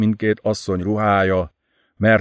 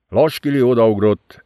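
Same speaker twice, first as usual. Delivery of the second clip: usual speaking voice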